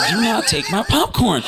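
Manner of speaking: high-pitched mock indignation; annoyed. Guarding popcorn from a stranger, annoyed. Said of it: "I'm a little annoyed at him".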